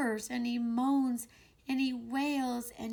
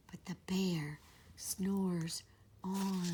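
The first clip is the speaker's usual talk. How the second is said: soft whispered refrain; whispered storybook refrain; 3 semitones lower, 7 dB quieter than usual. A narrator's reading. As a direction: whisper it like the bear might wake. Hushed refrain, sleeping bear.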